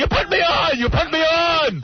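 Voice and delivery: hammed-up high screaming accusation; screaming father. Father accusing his son of mocking him, mock screaming. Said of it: "He'd start screaming".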